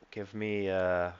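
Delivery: ordinary delivery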